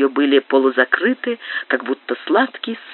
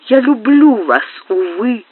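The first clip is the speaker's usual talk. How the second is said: despairing cry; 8 semitones higher than usual. A narrator's reading. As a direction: cry out hopelessly in love. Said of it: "воскликнула она с отчаянием". (с отчаянием, выкрикнуто)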